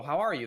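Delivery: "How" punched high and loud, then a fall on "you"; stress on HOW. Shown as a demonstration. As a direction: hit "How" hard so it can't be brushed off. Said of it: "emphasizing the word "how".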